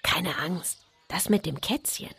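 papa's soft, hushed reassurance. Acted. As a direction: let her in on a secret. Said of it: "senkte die Stimme und flüsterte".

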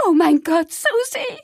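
very high, loud shriek of alarm; shrieking mother in horror; horrified cry. A narrator's reading. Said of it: "rief eine andere Mutter". (schriller, entsetzter Aufschrei)

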